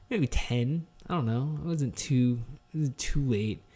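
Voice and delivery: ordinary talk, nothing marked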